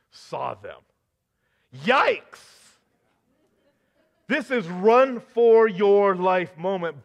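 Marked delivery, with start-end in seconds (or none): 1.8-2.7 high, loud startled cry; startled alarm; yikes. Acted. Reaction to prophets ascending. 4.3-7.0 urgent warning. Acted. Preacher urging alarm.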